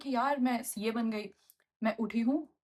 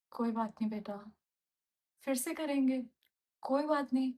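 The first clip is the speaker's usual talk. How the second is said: softer, gently consoling mother's voice; 4 dB quieter than usual. Retold or imitated. Mother consoling daughter she thinks failed, softly. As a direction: comfort her like mom did, softly. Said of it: "gate खोल के कहती".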